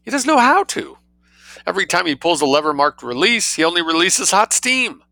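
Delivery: high, frantic cry of alarm; fearful intensity. A narrator's reading. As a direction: panic over the husband stuck in the bath. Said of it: "speaking with a fearful intensity".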